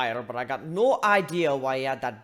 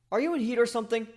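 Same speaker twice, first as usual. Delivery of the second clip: blurted; 9 semitones higher, 3 dB louder, faster than usual. Acted. Blurted question.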